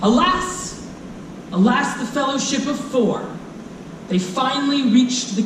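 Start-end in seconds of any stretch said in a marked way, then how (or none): none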